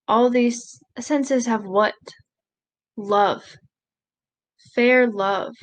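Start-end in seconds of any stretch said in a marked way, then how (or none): none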